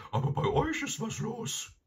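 very high, saucy hound voice; high-pitched backchat from the dog; cheeky dog. A narrator's reading. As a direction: answer back as an impudent talking dog. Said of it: "hatte Garm ihm frech geantwortet".

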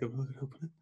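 hushed urgent urging; urgent whisper. Retold or imitated. Pupil secretly urging mate, whispering. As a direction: whisper so teacher won't hear.

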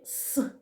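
"с" as a bare hiss; teacher's demo of the lone consonant "с"; isolated sound. Shown as a demonstration. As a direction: sound out "с" alone, not as a letter name. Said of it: "Перший звук у слові".

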